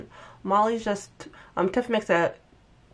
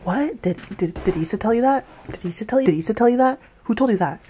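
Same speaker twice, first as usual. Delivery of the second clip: higher and louder, defensive rapid-fire questions; imitating triggered outburst; 4 semitones higher, 6 dB louder, faster than usual. Recapping a show, mocking Molly snapping at Tiff, higher and louder. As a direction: play her getting triggered and defensive. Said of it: "Molly gets triggered".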